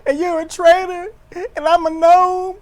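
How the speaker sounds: high, loud, whiny mock voice; whiny Kevin. Mocking Kevin blaming Cody, high whiny whimper. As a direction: whine it like a sulking child.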